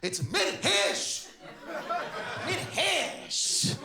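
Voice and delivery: MIRHESH in two slow parts, second part punched; passionate pronunciation. Retold or imitated. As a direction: relish "Mirhesh" with Arabic passion.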